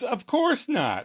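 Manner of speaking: high, slow, unconvincing denial; lying. A narrator's reading. Monica lies to Chandler, falsely reassuring. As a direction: stretch out a falsely innocent denial. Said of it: "And Monica lies".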